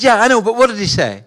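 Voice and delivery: a lightly voiced mother, a touch impatient; a mum pressing her son for the answer. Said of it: "She said". (une mère un peu impatiente, voix à peine jouée)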